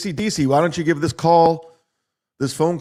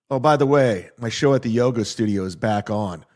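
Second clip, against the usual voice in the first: normal talking voice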